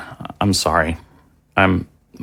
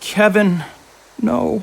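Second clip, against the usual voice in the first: grief-stricken; 13 semitones higher, much slower than usual. Acted. Father watches son die.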